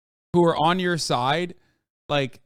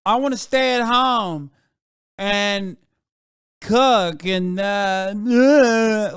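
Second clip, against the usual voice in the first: mock bimbo; 4 semitones higher, 5 dB louder, slower than usual. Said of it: "like, the bimbo who's like".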